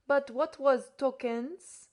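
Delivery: slow, polite French-tinged question; patient courtesy. A narrator's reading. Frenchman asking Podsnap, slow and courteous. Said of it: "with patient courtesy".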